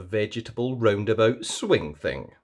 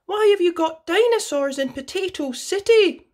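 put-on much higher, puzzled child's question; confused Susie; 4 dB louder than usual. A narrator's reading. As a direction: ask it baffled and high. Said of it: "Susie is confused".

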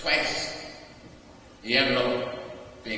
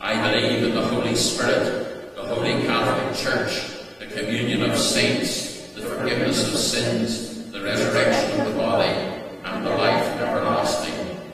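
loud, steady communal recitation; creed recited aloud; 3 semitones lower, 5 dB louder than usual. Acted. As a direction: recite together, every word firm.

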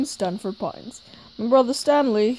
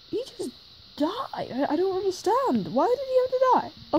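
shaken disbelief; 9 semitones higher than usual. Acted. Character reacts to a death.

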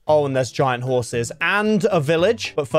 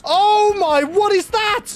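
high, loud gasp of disbelief; stunned at the sight of it; shocked squeal; 4 dB louder, slower than usual. Real, not acted. Sees huge monster suddenly.

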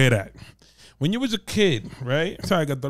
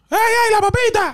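shopkeeper yelling; 4 dB louder than usual. Retold or imitated.

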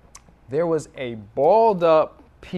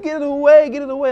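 put-on high, loud panicky child's cry; mock the son's panic at the fan; imitating a screaming toddler; 11 semitones higher, 9 dB louder, much faster than usual. Father imitates terrified son at fan, high panicky cry. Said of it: "he starts to claw me and scream".

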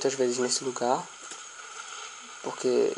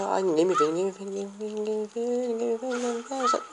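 higher and higher, nervous chant; pleading not to be seen; being chased; 7 semitones higher than usual. Real, not acted. Sneaking past zombies, rising panic.

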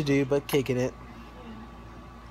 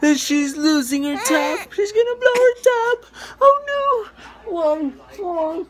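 high, loud wail of alarm; playful high-pitched panic over a fussy baby; mock panic; 5 dB louder than usual. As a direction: wail it like disaster is about to strike.

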